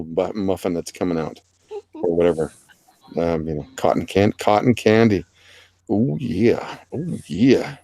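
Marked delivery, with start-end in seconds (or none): 5.9-7.8 lightly voiced wrestler impression; drawn-out catchphrase in a put-on voice; Macho Man. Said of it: "that's my best Macho Man".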